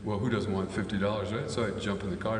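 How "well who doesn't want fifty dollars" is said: usual speaking voice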